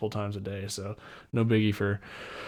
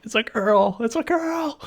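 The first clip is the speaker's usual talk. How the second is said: much higher, loud and excited; ecstatic announcement; 13 semitones higher, 5 dB louder than usual. Retold or imitated. New dad announcing the girl to Jen, much higher and overjoyed. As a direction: shout it out, overjoyed.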